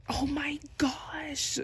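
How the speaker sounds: slow, breathless whisper. Real, not acted. Fan dismayed by the creaking album, slow whisper. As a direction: hush it out in dismay. Album packaging makes weird sound.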